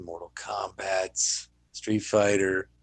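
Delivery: ordinary talk, nothing marked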